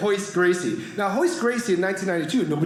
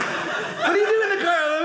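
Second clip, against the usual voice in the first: much higher mock questioning voice; exaggerated questioning; 12 semitones higher than usual. Friend finding comic asleep in his car, exaggerated questioning. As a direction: pitch it way up, hammed-up disbelief. Replaying the scene at his expense.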